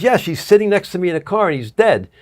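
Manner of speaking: a touch of the widow's panic; retold panic. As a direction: replay her frantic words on the phone. Widow quoted on phone.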